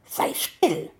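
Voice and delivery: whispered hiss. A narrator's reading. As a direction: hiss it into his ear. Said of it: "zischte diese ihm ins Ohr". (gezischt ins Ohr)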